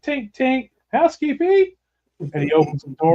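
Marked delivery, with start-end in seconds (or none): 0.0-1.7 high chirpy maid voice, rising higher on housekeeping; mock housekeeper. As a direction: sing "Tink, tink" high then lift "housekeeping" even higher. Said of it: "so I'm like".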